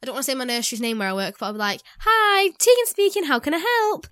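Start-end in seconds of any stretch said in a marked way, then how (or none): 2.0-4.1 slow, high, formal call-answering voice; posh phone voice. Retold or imitated. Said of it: "I have this posh voice".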